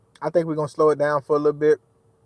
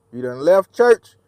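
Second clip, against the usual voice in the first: slow loud scolding; 3 semitones higher, 7 dB louder, slower than usual. Pretend. Scolding a driver who cut in.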